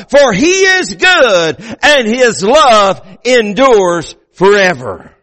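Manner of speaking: slow, ringing shout of praise; proclaimed shout. Acted. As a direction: stretch it out and call it to the church. Climax of the praise.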